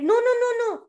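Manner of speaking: high, insistent little duck; protesting Ducky. A narrator's reading. Duck contradicting the boastful bear, high protest. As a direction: object in a rapid high string. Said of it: "Ducky said".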